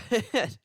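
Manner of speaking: sudden loud, high burst of laughter; startled laugh. Real, not acted. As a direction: burst out laughing after a jolt. Microphone has just shocked the reader.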